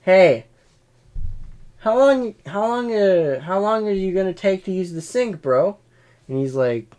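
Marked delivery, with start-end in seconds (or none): 0.0-5.8 mock confrontation. Imagined confronting coworker.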